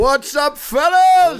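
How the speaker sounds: shouted hello to the room; loud greeting. Real, not acted. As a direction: belt out the greeting.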